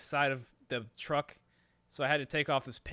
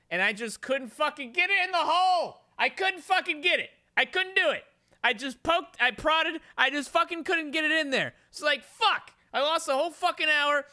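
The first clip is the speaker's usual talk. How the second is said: high, loud, exasperated venting; frustrated shouting rant; 11 semitones higher, 7 dB louder than usual. Retold or imitated. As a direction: yell it like you've lost an hour in the heat. Relives an hour of failure.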